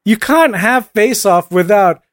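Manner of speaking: ordinary delivery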